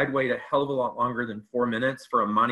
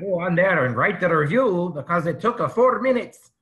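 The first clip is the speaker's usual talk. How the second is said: louder, higher hammed-up Irish accent; mock Irish accent; 6 semitones higher, 5 dB louder than usual. Imitating the Irish reviewer for the cohost, mock Irish accent. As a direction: play an indignant Irishman, thick mock Irish. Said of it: "if I can do an Irish accent".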